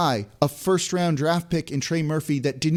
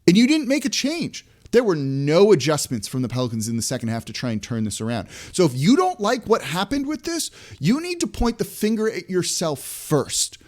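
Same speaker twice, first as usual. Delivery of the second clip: real frustration; 4 semitones higher than usual. Real, not acted. Host scolding the coach over the blowout, frustrated. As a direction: let exasperation drive the stressed words. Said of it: "You hear the frustration in my voice".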